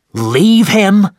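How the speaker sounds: high, loud incredulous cry; captain outraged at the idea of giving up, shouting. Acted. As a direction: fling the words back in disbelief. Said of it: "shouted Captain Ahab".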